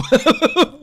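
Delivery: quick high burst of laughter; high giggle. Real, not acted. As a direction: giggle at how far off topic it went. Laughing at derailed podcast.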